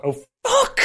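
high squeaked curse of panic; panicked outburst. Acted. Man recognising the mark, panicked squeal. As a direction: jump way up in pitch, panicking.

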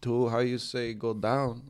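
put-on halting, soft Mexican-accented English; quiet imitated Mexican accent. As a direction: grope for the words in a Mexican accent. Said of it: "the quintessential Mexican accent".

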